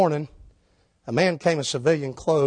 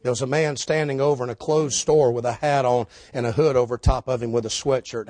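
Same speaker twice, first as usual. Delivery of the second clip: ordinary delivery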